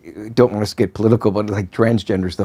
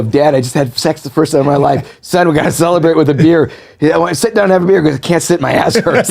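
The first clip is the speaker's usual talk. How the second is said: joke voices; 7 semitones higher, 4 dB louder than usual. Retold or imitated. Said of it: "that's the old joke".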